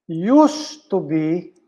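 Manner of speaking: USED stretched out slow and weighty; emphasis on used to be. Shown as a demonstration. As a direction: lean hard on used to be, slowly.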